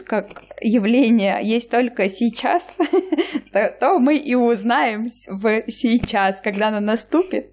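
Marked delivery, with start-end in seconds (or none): none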